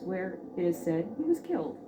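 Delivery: ordinary delivery, nothing marked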